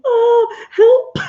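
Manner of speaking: a tiny, high, giggly "help"; mock panicked squeal. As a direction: squeak it like a playfully panicked friend. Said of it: "And she was like".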